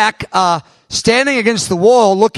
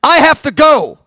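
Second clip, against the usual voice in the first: louder and higher, suddenly urgent; urgent exclamation; 7 semitones higher, 6 dB louder than usual. Retold or imitated. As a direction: blurt it out, you must go now. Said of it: "And I said".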